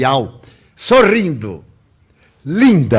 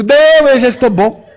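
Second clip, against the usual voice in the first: high, louder pleading "no, I'm fine"; panicked protest; 8 semitones higher, 5 dB louder than usual. Retold or imitated. The patient begs the nurse to stop the burning, panicked. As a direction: beg her to stop, half laughing. Patient refusing a second burn.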